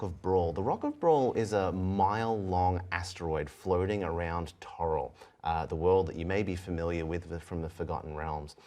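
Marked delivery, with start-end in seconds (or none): none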